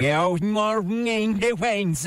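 slow garbled reversed Good morning, Wayne; played back in reverse, slow and warped; reversed playback. Shown as a demonstration. Host plays his backwards recording in reverse, slow warped sound. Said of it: "if it carries over here".

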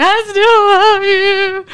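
very high, loud, drawn-out wail; hammed-up heartbroken howl, stretched slow; mock breakdown wail. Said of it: "starts breaking down, being like".

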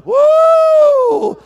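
sudden high yelp of joy, full voice; high shouted whoop. Real, not acted. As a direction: let out a loud, high church "woo". Preacher whoops mid-sermon.

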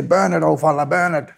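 imitating his young eager self; eager young fella. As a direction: egg the old man on, pitch up high. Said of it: "I was that young fella, you know, going".

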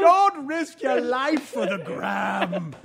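put-on slow, high Jamaican accent; laughing through a Jamaican accent impression. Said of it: "became the mantra".

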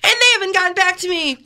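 high, aggrieved courtroom complaint; indignant TV-court plaintiff. Acted. Plaintiff pleading to a TV judge, indignant. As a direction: plead your case like a wronged litigant.

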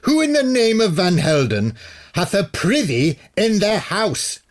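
put-on high, outraged old warlock voice; be scandalised by indoor privies; horrified warlock. A narrator's reading. Warlock appalled that privies are indoors. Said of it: "Murdin bellowed in horror".

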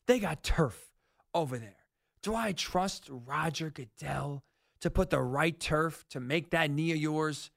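ordinary talk

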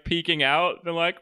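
normal talking voice

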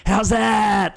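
high-pitched heckling yell; mock scream. Blokes heckling a fielder from a passing car, mock scream. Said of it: "and scream".

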